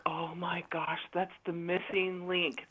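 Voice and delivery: breathy whispered gasp of discovery; hushed awe. Retold or imitated. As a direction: whisper it like a revelation. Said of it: "And I'm like".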